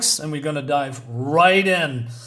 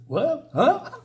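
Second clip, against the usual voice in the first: puzzled; 9 semitones higher than usual. Acted. Reads Duncan's puzzled reply.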